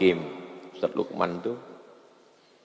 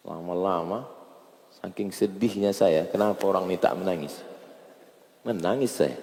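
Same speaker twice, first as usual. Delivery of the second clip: usual speaking voice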